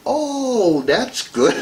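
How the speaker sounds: high swooping "oh", then dropping back; mock approval. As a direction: play an over-impressed listener. Acted polite reaction to ancestry boasts.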